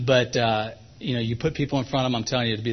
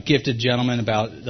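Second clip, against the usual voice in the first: usual speaking voice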